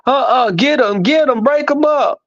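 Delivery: high, frantic pleading to break up the dogfight; imitating a nervous owner begging someone else to step in; high-pitched alarmed plea; panicky Jay. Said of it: "That's when Jay wants to intervene".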